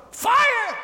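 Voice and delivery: high-pitched, loud shout of alarm; alarmed cry. A narrator's reading. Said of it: "cried Missus Prothero".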